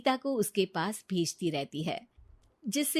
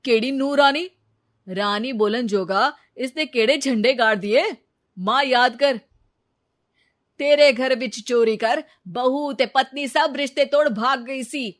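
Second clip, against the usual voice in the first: louder, scornful protest; angry objection; 4 semitones higher, 8 dB louder than usual. Acted. Raghav objecting to his mother, angry. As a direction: remind her what the wife did.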